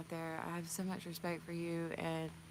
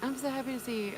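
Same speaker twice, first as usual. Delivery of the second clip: delighted greeting; 8 semitones higher than usual. Real, not acted. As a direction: squeal a welcome to a friend arriving. Greeting a viewer joyfully.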